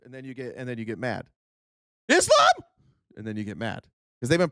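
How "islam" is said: very high, loud triggered yelp; mocking a triggered viewer's yell; mock panicked shout. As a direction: scream it like a scared voter. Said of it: "you're like".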